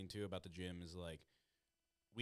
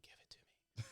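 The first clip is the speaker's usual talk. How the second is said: low-volume, fully whispered demand; 7 dB quieter than usual. Retold or imitated. Grappler wanting the pinned partner to move, whispered. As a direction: whisper it close to their ear. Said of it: "I'll whisper in there".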